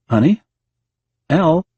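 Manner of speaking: husband calling for a wife who won't answer. Acted. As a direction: call her name, unsure.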